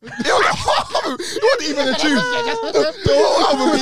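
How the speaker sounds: high, loud laughing disbelief; laughing outrage. Real, not acted. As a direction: rant through laughter. Laughing rant about the album.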